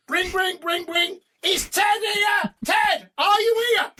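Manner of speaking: high, loud, playful calling out of the pub phone; mock barmaid calling. Imitating the pub calling for Ted, loud and high. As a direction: call out high across the pub. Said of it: "you get the phone".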